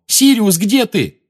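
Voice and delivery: loud high calling out; shouting. Acted. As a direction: call into the empty kitchen. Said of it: "крикнул он".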